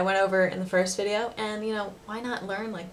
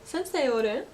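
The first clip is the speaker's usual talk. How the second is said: Osaka question; 5 semitones higher than usual. Shown as a demonstration. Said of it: "So, "orun?".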